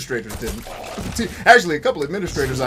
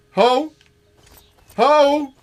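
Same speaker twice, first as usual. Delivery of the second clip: much higher, much louder mock call; 14 semitones higher, 10 dB louder than usual. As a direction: shout across the street for her. Pimp calling out for someone.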